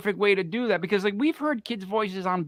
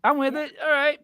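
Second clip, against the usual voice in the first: higher, amused going along with it; bemused acceptance; 8 semitones higher than usual. Retold or imitated. Host retelling how he humored the Southern man, high and amused. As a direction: shrug it off, pitched up and wry. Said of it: "And I'm like".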